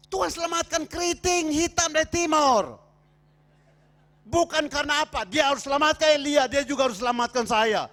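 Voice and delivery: seruan khotbah lantang dan tinggi; khotbah berapi-api. Real, not acted. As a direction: serukan tiap frasa kepada jemaat dengan tenaga penuh. Seruan khotbah penuh tenaga. (berkhotbah lantang berapi-api)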